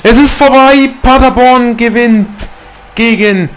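high, loud victory yell; slow, loud celebration cry; triumphant shout. Real, not acted. Gamer celebrates beating Bayern.

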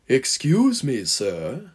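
ooze with false politeness; oozing charm. A narrator's reading. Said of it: "he oozed".

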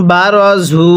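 বারজু with a drawn-out vowel; correct Quranic reading. Shown as a demonstration. Teacher models বারজু for students, drawn out. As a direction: recite বারজু slowly, lengthening the vowel. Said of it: "কিভাবে পড়তে হবে?".

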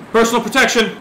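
loud, high exclamation; emphatic shout. Real, not acted. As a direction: belt it out proudly. Repeats phrase emphatically.

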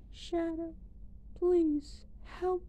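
put-on high, soft girl's voice; soft high voice of a lost friend; Maria's voice. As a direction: voice a gentle girl remembered from the past. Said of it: "Remember what Maria told you".

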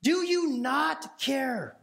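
mock hurt, dragging the final words; slow, pleading complaint. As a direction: stretch the last question like a plea. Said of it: "she's like".

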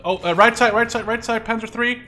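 high, urgent shouted warning; real alarm in the voice; urgent callout. Real, not acted. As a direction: call out the tank position fast. Calling out enemy tank.

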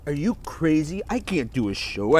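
usual speaking voice

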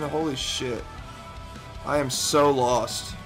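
usual speaking voice

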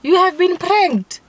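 high, loud gleeful announcement; spring the prank on him gleefully; prank reveal laughing. Real, not acted.

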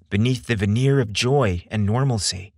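usual speaking voice

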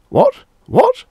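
high shocked stammer; stuttering in shock. Acted. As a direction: stammer it, thrown. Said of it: "stuttered Mister Bradbury".

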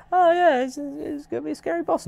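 high, hammed-up gullible voice; mock naive hype. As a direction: play the fooled player. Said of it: "You think".

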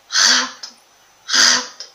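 RATO said loudly with a forceful throaty R; strong R. Shown as a demonstration. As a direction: hit the first sound of RATO hard, from the throat. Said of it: "é o som do R forte". (RATO com o R forte bem destacado)

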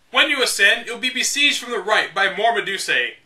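measured, deliberate strategy-guide narration; guidebook read-aloud. Acted. Reading old game guide to viewers, deliberate. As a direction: read it like the official manual, step by step.

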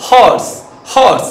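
হর্স with a clear breathy h at the start; হর্স with the h pronounced; sounded h. Shown as a demonstration. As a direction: give হর্স its h. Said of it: "এইচ এর উচ্চারণ হচ্ছে কি না?".

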